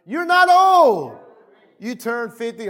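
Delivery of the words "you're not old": high, drawn-out preacher's shout; shouted rebuke. Real, not acted. Preacher answering people who complain at forty, shouted rebuke. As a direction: shout it down, stretching the last word.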